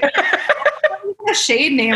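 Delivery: slow, drawn-out question through laughter; laughing disbelief. Retold or imitated. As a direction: laugh while asking, baffled. Retelling a baffling client moment.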